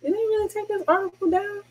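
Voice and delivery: can't believe it's gone; high hushed shock; incredulous. Real, not acted. Article gone.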